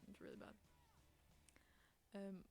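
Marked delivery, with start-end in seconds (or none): none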